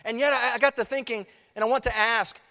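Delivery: high, loud pulpit fervor; full-force preaching. Acted. Preacher warns the congregation, loud and fervent. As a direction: drive it home to the church.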